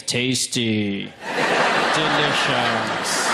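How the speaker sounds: deep slow game announcer voice; Candy Crush voice. Retold or imitated.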